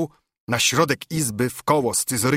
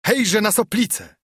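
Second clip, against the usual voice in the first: higher, lightly shouted call; rallying cry; 7 semitones higher than usual. A narrator's reading. Nobles rally against Soplica, rallying cry. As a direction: rouse the crowd to attack. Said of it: "krzyknęli wszyscy".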